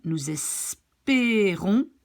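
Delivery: "espérons" slow, with ay-like é; closed é. Shown as a demonstration. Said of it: "It's more pronounced like E".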